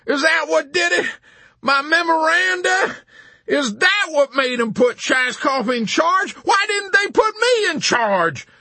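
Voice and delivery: very high, loud, hysterical protest; anguished wail. A narrator's reading. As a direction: shriek the questions in dismay. General wailing at his own undoing.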